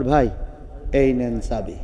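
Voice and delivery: low, softened giving-in; meek surrender. Acted. Preacher playing the cowed keyholder handing it over, low meek voice. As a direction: drop low and soft, giving in. Frightened man surrenders the key.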